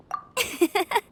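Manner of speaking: a quick easy chuckle; short laugh. Acted. Scout laughing to cheer up the travelers, light chuckle. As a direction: laugh off the setback to lift their spirits.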